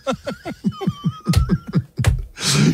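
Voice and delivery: a burst of squealing laughter; high shrieking laugh. Real, not acted. Huge laugh at the bit.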